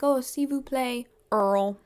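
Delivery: lightly put-on French accent on "Earl"; imitated French accent. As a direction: attempt "Earl" as a French speaker would. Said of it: "carrying the French accent".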